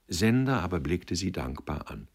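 ordinary delivery

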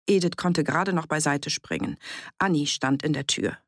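plain reading voice

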